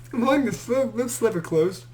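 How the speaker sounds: ordinary talk, nothing marked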